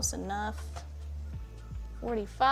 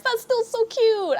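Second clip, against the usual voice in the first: cute anime streamer; 9 semitones higher, 4 dB louder than usual. Real, not acted. Praising viewer's drawing.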